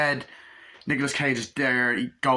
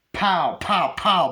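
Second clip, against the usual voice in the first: mock gunshot pows, higher and louder; Gary Oldman gunshots; 6 semitones higher, 5 dB louder, much slower than usual. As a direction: spit out playful gun noises like Gary Oldman. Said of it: "doing an impression of Gary Oldman".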